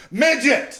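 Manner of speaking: put-on loud high blurt of a kid; daughter blurting. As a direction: shout it out like a tactless little girl. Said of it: "stands beside me and goes".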